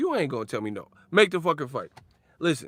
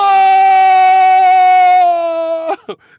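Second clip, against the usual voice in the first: long screamed no; 18 dB louder than usual. Retold or imitated.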